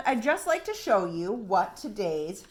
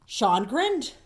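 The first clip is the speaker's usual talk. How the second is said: plain reading voice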